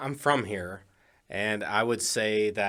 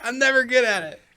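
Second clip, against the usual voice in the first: high, loud laughing squeal; helpless high-pitched laughter through the words; 13 semitones higher, 7 dB louder than usual. Real, not acted. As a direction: crack up at your own failed attempt. Laughing after failed accent attempt.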